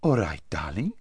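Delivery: soft, languid agreement; lazy voice. A narrator's reading. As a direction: agree lazily, rising at the end. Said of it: "in her lazy voice".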